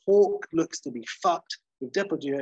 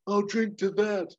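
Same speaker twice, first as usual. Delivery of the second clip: slow, raised hero one-liner; closing quip; 7 semitones higher, 3 dB louder than usual. Retold or imitated. Hawk's last quip before credits, slow raised. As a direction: toast it like a final line. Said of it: "And Hawk says".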